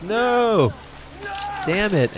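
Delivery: sudden high yell of defeat; frustrated yell. Retold or imitated. Utah yelling at himself after being handcuffed, frustrated high shout. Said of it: "So he's like".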